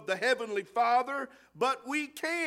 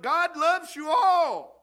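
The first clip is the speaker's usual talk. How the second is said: loud, higher and slow, a peak of the sermon; preacher's shout; 5 semitones higher, 7 dB louder, slower than usual. Real, not acted. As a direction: call it out to the whole hall. Preacher's climax on God's love.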